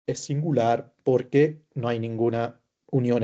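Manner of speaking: ordinary talk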